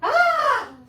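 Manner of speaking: very high, loud mock scream; sudden piercing yelp, played for laughs; imitated passengers' scream. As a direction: shriek like a startled planeful of passengers. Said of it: "todos gritaron".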